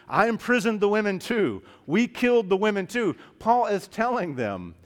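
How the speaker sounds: high, loud, driving sermon delivery; impassioned preaching. Acted. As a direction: preach it forcefully as Paul's own testimony. Preaching at full force as Paul.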